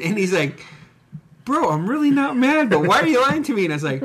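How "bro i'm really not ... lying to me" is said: high-pitched imitation of a hurt friend; mock pleading friend. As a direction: plead in a raised, wounded voice. Voicing friend Ed in story.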